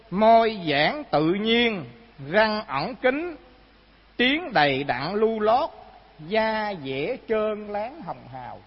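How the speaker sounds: slow, emphatic reading aloud; recited sacred biography. A narrator's reading. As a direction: read it out deliberately, phrase by phrase. Recites the written life story aloud.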